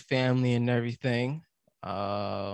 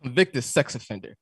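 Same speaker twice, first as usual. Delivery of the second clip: anchor read breaking into laughs; laughing through the read; 5 semitones higher, 7 dB louder than usual. Real, not acted. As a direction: read it while trying not to laugh. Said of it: "you can't laugh when you you're laughing".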